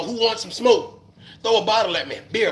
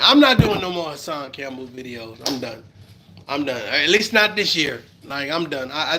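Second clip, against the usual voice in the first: normal talking voice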